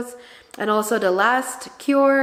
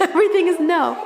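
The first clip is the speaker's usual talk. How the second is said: laughing; 7 semitones higher, 4 dB louder, faster than usual. Real, not acted. Laughing at toddler.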